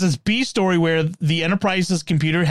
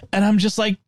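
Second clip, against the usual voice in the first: normal talking voice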